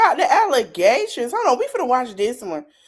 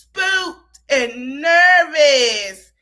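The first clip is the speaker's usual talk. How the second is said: very slow, louder, drawn-out spooked and nervous; Armand voice; 4 semitones higher, 6 dB louder, much slower than usual. Retold or imitated. Host redoes spooked and nervous in her friend Armand's voice, very slow. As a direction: stretch every word like her friend Armand would. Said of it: "say it in my boy Armand voice".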